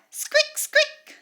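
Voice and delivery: squeak, rising to a very high loud squeak; a shrill little mouse squeak; rat squeak. Shown as a demonstration. Teacher demonstrates the rat's sound, very high squeak. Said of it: "What is the sound?".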